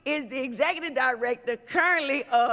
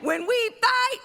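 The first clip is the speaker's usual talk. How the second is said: shouted preaching; 8 semitones higher than usual. Acted. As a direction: whip the crowd up. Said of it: "I'ma act like I'ma preach".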